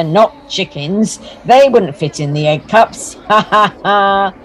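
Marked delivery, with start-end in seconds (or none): 3.3-4.3 ha ha ha that won't stop; helpless laughter. Acted. Big wheel to Dorothea, can't stop laughing. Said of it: "still laughing so much".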